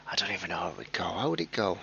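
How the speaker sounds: whispered to himself. Real, not acted. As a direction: mutter it under your breath. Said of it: "unvoiced whisper".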